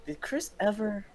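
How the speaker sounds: ordinary talk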